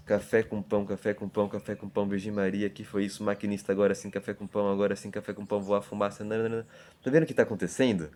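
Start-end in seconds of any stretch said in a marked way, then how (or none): 0.1-6.7 soft rhythmic chant; poem recitation. Shown as a demonstration. Teacher quoting the poem to show its rhythm, soft chant. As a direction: chant it softly like a train's beat. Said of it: "Quando ele fala".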